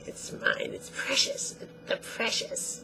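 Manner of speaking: hissing, whispered greedy Gollum; a hoarse possessive whisper over the ring. Retold or imitated. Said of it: "as greedy as Gollum is".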